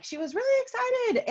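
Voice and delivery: squealed high with excitement; gushing. Retold or imitated. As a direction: gush it out like a thrilled fan. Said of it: "I'm like".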